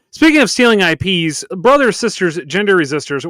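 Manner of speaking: loud preacher-style rallying cry; rally the crowd, big and loud; roaring rallying speech. Acted. Podcast host rallies listeners.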